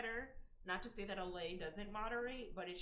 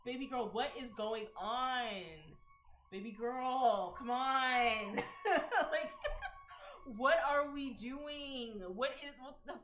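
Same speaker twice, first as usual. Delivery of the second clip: exasperated; 4 semitones higher, 6 dB louder, slower than usual. Real, not acted. Exasperated calling out to her.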